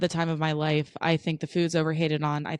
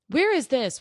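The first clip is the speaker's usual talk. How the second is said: higher-pitched mock customer question; imitated customer; 8 semitones higher, 3 dB louder than usual. Customer asking staff for directions, lightly mimicked. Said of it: "have to be the customer service".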